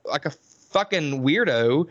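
normal talking voice

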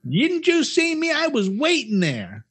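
high, slow, whiny indignation; mocking indignant woman. Retelling the parking-lot woman, a high mocking whine. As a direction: sneer her complaint back at her.

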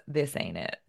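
Southern professor giving a blunt verdict; Southern accent. Retold or imitated. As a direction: imitate a teacher's Southern accent.

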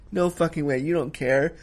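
usual speaking voice

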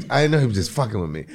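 usual speaking voice

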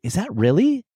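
high, rising, taken-aback question; startled disbelief. Retold or imitated. Husband reacting to his wife's cutting remark, surprised. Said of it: "almost surprise".

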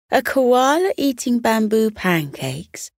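ordinary delivery, nothing marked

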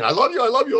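high, eager I love you; lovingly eager, pitch lifted high; gushing affection. Retold or imitated. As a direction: pour the words out with delight. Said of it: "that's me saying".

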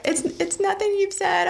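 high-pitched mock crying voice; tearful. Patient reassuring the doctor it's not him, hammed-up tearful. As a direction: imitate blubbering reassurance through tears. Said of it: "Tears again".

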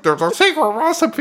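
very high, mock ventriloquist's dummy; high-pitched dummy voice. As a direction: imitate the silly dummy in a high squeak. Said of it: "the dummy's like".

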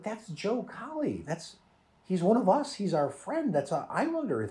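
high, hushed protest; mock horrified. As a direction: plead in imitation of horrified followers. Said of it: "they're horrified that she's talking to them".

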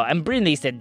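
plain reading voice

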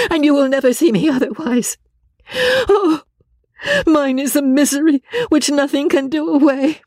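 very high, tormented wailing voice; distraught Marianne. Acted. Marianne despairing.

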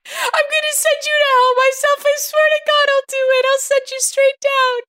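high hammy threats; soap opera acting. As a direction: vow revenge high and theatrical. Mother threatens the prankster.

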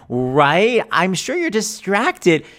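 hammed-up sarcasm; sarcastic. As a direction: drawl out fake understanding. Sarcastic reply.

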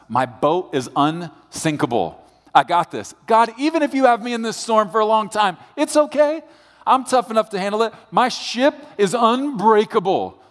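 high, pushed voice of pride bragging to God; boastful pride. Acted. Pride talking back to God in a storm, high and boastful. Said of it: "Pride says".

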